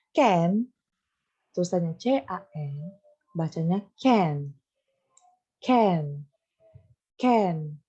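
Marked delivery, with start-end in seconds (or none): 0.1-0.7 "Can" said slowly and clearly for the class to repeat; model pronunciation of can. Shown as a demonstration. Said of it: "ini Miss akan jelaskan". 5.6-7.8 "Can" said slowly and clearly for the class to repeat; model pronunciation of can. Shown as a demonstration. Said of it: "Coba diikutin di rumah".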